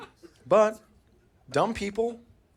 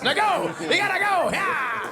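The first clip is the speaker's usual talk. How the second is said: put-on kid hyping sister; 12 semitones higher, slower than usual. Son hyping up his sister to go, high and excited. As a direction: send her off like a coach.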